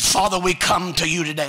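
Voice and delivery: suddenly loud, preached-out prayer; re-enacted booming prayer opening; imitated fervent prayer. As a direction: call out to God with force, acting her prayer. Acts out mother's anointed prayer.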